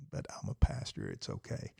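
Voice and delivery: barely voiced, dropped to almost nothing; sheepish whispered aside; muttered aside. Real, not acted. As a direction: toss it off under your breath. Muttered aside after apologizing for preaching.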